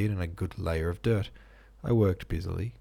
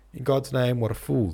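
raised, exasperated exclamation; higher, louder outburst, still contained; furious bellow; 5 semitones higher, 5 dB louder than usual. A narrator's reading. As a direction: exclaim it in mild fury. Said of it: "bellowed furiously".

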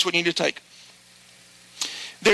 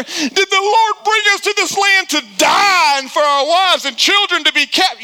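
mock whining complaint; 7 semitones higher, 4 dB louder than usual. Israelites complaining to God.

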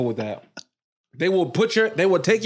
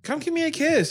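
higher, coaxing girl's voice, lightly put on; a light imitation of a girl pleading sweetly; mock girl asking for a kiss; 11 semitones higher than usual. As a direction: tease him like a girl begging for a kiss. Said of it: "I hate when girls ask me".

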